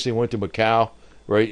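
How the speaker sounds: ordinary talk, nothing marked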